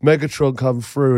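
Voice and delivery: ordinary talk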